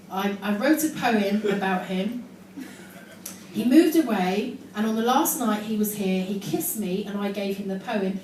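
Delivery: ordinary talk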